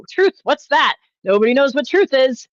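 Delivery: high, loud sarcastic mimicry; mock exasperation. Streamer mocking critics' dodge, loud sarcastic mimic. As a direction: mimic them waving away the truth. Mocking their muddying of truth.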